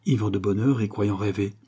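plain reading voice

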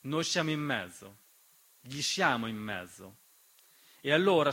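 ordinary talk

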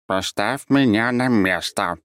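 a hint of a slow, even creature voice; lightly voiced cat, slow and even; cat's normal voice. A narrator's reading. Cat tells the narrator to put him down, slow and even. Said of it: "нормальным голосом сказал он".

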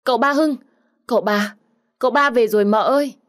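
loud, high call, relieved as if she struck gold; calling out with relief. Acted. Maid hails her master coming home, loud relieved call. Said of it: "thì la lên như vớ được vàng".

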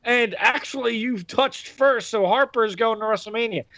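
high-pitched mock announcement voice; Shane McMahon. Host acting out next week's ruling, high put-on voice. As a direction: put on a high official's voice, easing down. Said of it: "Shane McMahon goes".

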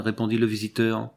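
plain reading voice

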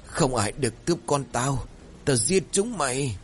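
a father howling in despair, half-voiced; desperate howl. A narrator's reading. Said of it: "miệng chỉ rú lên một câu lặp đi lặp lại". (tiếng rú tuyệt vọng, giọng cao)

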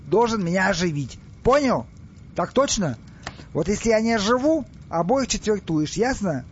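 high-pitched, insistent orders; commanding pharaoh. Acted. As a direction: drill the threat into a subordinate. Pharaoh threatens and orders his commander. (властно, с угрозой)

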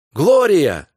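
a loud relieved call of her name. Acted. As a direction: shout her name as you run to her. Husband runs calling wife.

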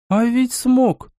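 high, drawn-out amazed remark; surprised. A narrator's reading. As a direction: stretch it out in high-pitched surprise. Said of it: "удивлённо подняла брови Ласка". (удивлённо, высоко и протяжно)

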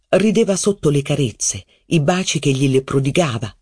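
ordinary delivery, nothing marked

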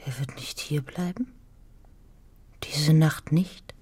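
slow, unvoiced whisper. Anna whispering to Jette, fearful. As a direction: whisper it in dread.

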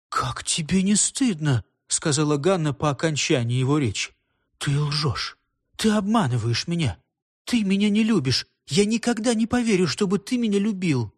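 hurt accusation, rising as it builds; wounded reproach. A narrator's reading. Ганна accuses the man of lying, wounded reproach.

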